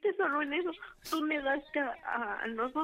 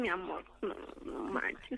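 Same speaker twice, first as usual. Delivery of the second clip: shocked near whisper; 6 semitones lower, 6 dB quieter than usual. Acted. Daughter hears the mistress.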